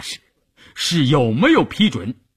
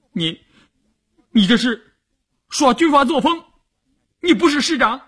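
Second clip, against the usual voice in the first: higher, shaky-voiced young woman protesting; hurt protest; 13 semitones higher than usual. Young wife protests at her husband, hurt and higher. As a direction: blurt it out, wounded and indignant.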